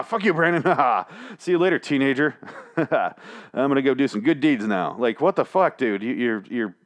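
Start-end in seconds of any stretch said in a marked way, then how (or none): 0.1-5.0 high, laughing send-off to a victim; gleeful mock taunt. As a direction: play a cheery killer, chuckling between phrases. Mocking killer's gleeful farewell to victim.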